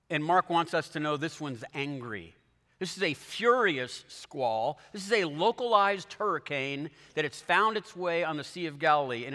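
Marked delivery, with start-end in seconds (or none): none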